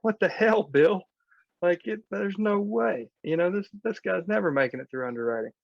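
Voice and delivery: high-pitched incredulous objection, easing toward the end; mock exasperation. Lender objecting to a bad referral from Bill, exasperated. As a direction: imitate a lender balking in disbelief, starting high.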